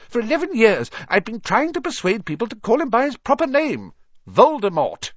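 high, loud, emphatic; emphatic insistence. Acted. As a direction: raise the voice to press the point. Dumbledore insists on the name.

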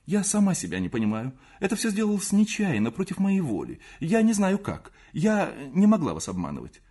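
high, pleading, distressed confession; умоляющий голос. A narrator's reading. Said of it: "сказала Наденька умоляющим голосом". (умоляюще, высоко, оправдываясь)

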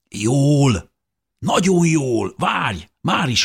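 high, eager shout down; excited call. A narrator's reading. Calling down to Dávid from the surface, excited. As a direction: call down full of joy.

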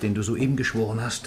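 normal talking voice

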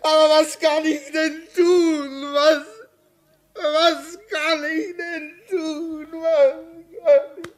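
high, sobbing cry; crying despair. Acted. Signalman crying out to the doctor in despair, sobbing. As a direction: plead helplessly through tears.

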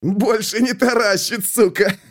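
high gleeful words breaking into a giggle; manic giggle. A narrator's reading. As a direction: giggle like a madman. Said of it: "бесновато захихикал он".

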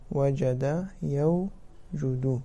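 وجد يوجد stretched out syllable by syllable, very slow; slow, deliberate teacher's demonstration; slow drilled demo. Shown as a demonstration. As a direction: spell out وجد يوجد slowly for the class. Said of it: "teacher drills the verb form".

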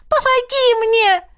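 put-on girl in tears screaming for help. A narrator's reading. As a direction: cry out with all your strength. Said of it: "кричу изо всех сил".

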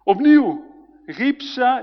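ordinary talk, nothing marked

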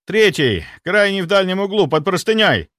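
high, loud, agitated answer; urgent reply. A narrator's reading. Inquisitor answering the captain's question about the table, urgent.

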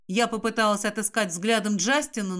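ordinary delivery

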